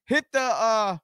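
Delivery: upbeat promo read of socials; podcast host outro. Acted. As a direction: plug the channels like an announcer. Host plugs socials at the end.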